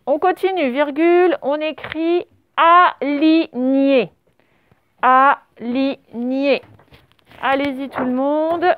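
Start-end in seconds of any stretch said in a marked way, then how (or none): 2.6-4.1 ALIGNÉ very slow, split a, li, gné; syllable-by-syllable dictation. Shown as a demonstration. Teacher dictates a new word to the class, dictation. 5.0-6.6 ALIGNÉ very slow, split a, li, gné; syllable-by-syllable dictation. Shown as a demonstration. Teacher dictates a new word to the class, dictation.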